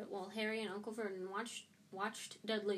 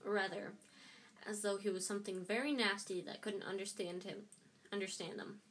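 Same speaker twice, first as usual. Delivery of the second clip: ordinary delivery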